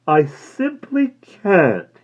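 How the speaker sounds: very slow, high and precise; prim refusal. A narrator's reading. Florist declining to help, very slow and prim. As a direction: refuse with exaggerated regret.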